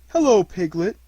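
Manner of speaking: a hint of a bumbling, cheerful bear voice; lightly voiced bear. A narrator's reading. Pooh plans to sing to Eeyore, light bear voice. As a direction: speak as a simple contented bear. Pooh's voice.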